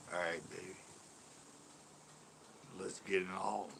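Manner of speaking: put-on low, soft crooning baby talk.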